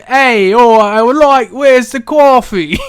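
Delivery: a hammed-up New Yorker barging in; mock New York accent. Mocking the wrestler as a D&D adventurer, loud mock New York voice. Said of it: "And he's like".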